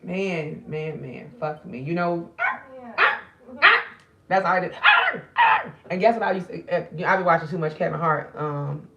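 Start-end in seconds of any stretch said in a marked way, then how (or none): 2.4-3.8 high squealing "ah, ah" yelps; mock high cry. Imitating a comedy bit with squealed ahs. 4.8-5.6 very high, loud "ah, ah" squeal; mock high squeal. Doing the comedy squeal she always does. Imitating a character's cry.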